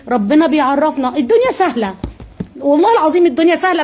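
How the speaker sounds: normal talking voice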